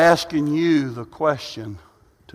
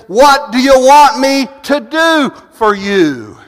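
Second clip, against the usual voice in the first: loud, high, slow, deliberate question; Jesus asking; 9 semitones higher, 9 dB louder, slower than usual. Acted. Preacher voicing Jesus to the blind men, loud and slow. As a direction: ask it slowly with full weight. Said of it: "He said".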